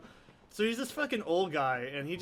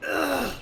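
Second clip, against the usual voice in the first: disgusted groan; 9 semitones higher, 6 dB louder than usual. Real, not acted. Disgusted reaction to the game.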